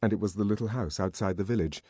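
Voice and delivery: ordinary delivery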